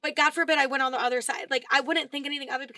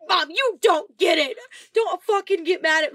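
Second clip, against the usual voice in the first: louder, slower snap at mom; angry at mom; 4 semitones higher, 6 dB louder than usual. Retold or imitated. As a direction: push it back on mom, loud and slow. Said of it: "mad at her 'cause I'm like".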